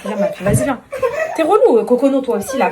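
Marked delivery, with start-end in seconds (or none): 1.3-2.7 annoyed. Real, not acted. Mother scolding the pestering kids.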